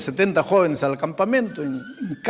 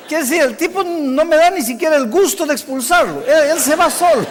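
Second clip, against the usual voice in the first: loud, high-pitched retold exasperation; indignant outburst; 6 semitones higher, 5 dB louder than usual. Preacher robbed of the pleasure of expelling the boy, indignant. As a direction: act out indignation, raising pitch and volume.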